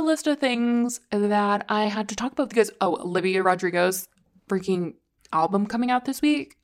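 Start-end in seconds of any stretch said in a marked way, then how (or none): none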